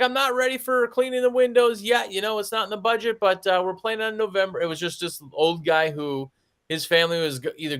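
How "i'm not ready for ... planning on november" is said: put-on old man voice. Old customer voiced, retold.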